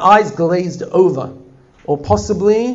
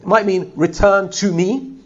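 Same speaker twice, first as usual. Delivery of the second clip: ordinary talk, nothing marked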